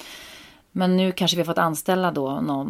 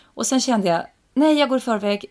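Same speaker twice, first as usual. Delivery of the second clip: usual speaking voice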